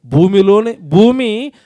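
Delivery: preacher proclaiming scripture slowly; solemn scripture reading. Acted. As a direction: stretch every word of the verse out. Reads the verse aloud.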